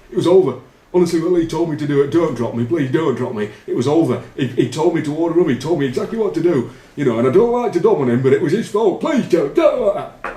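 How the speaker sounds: high, loud pleading mimicry; panicked confession. Teammate pleading with captain, hammed-up panicky high voice. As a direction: beg like a frightened teammate caught out.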